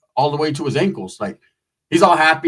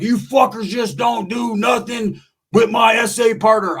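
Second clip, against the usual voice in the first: high, slow, drawn-out yell; acted defiant shout; 7 semitones higher, slower than usual. Roach defending his partner, defiant shout. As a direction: holler a mock warning, stretching the words.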